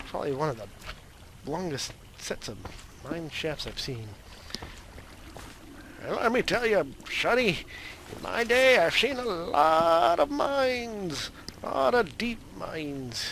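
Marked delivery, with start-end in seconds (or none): 6.0-13.2 put-on old-timer voice. Old miner telling Sonny about mines. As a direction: play a grizzled old miner lecturing a youngster. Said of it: "Why am I talking like this?".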